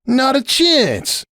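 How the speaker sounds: high, slow protest; cried out. Acted. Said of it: "Hoops cried".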